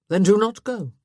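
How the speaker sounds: loud, blunt husband; curt retort. A narrator's reading. Husband answering his wife's objection, curt retort. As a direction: throw it back at her forcefully.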